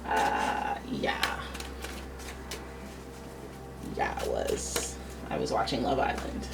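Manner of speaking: low, hushed sheepish admission; caught-out whisper. Retold or imitated. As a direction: own up in a whisper, busted. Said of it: "Is that an accent?".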